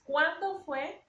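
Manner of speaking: slow, high question stressing CUÁN in "cuándo"; stressed question word. Shown as a demonstration. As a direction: ask it slowly, lifting "cuándo". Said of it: "tenemos que poner un acento en la A".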